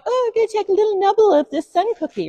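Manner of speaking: high, cutesy little squirrel voice. Pretend. Preacher voicing the disappointing cute squirrel picture, high and cutesy. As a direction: squeak it out like a dainty squirrel nibbling. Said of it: "Cute little squirrel going".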